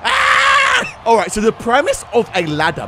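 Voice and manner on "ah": very high squealing yell; shrieking fan scream; excited scream. Acted. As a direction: let out a high held scream. Mimics or reacts to excited kid.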